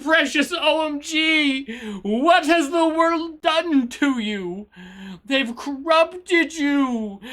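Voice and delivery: mock lament. Streamer grieving over sweet precious OMG's joke, theatrically anguished. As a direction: mourn a fallen innocent, hammed up.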